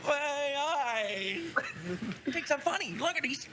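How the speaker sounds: high voice, helpless with amusement; acted laughter. Game master as a character delighted by the prank, laughing. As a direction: squeeze the words out after a laughing fit.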